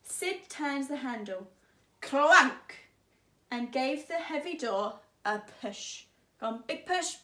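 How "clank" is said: clank. Acted. Door handle sound.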